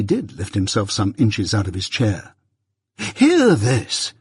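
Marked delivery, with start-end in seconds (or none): 3.2-4.1 high, exasperated cry; helpless exclamation. A narrator's reading. Mister Pocket exclaiming to the elements, helpless. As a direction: appeal helplessly to the heavens. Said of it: "he helplessly exclaimed to the elements".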